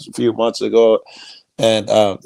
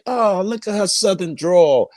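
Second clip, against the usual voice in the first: admiring mock; 10 semitones higher than usual. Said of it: "Now country".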